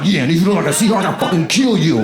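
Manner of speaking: fast, loud mock threat, half-laughing. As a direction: spit it out like a joking tough guy. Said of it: "I'm like".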